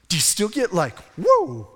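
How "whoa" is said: high, loud exclamation of wonder; amazed whoa. Acted. Longtime Bible reader struck by a verse, high amazed whoa. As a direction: burst out as if freshly struck.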